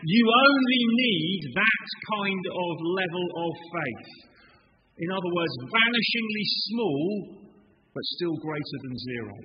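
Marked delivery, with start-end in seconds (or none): 0.1-4.3 slow, louder, higher stress; emphatic. Acted. Jesus to the disciples, slow emphatic point. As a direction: spell it out slowly. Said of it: "Jesus is saying".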